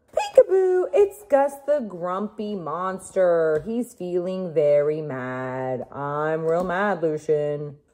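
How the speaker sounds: slow, loud storybook read; drawn-out storybook voice for a baby. Pretend. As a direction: read the book slowly to the baby. Reading board book to baby.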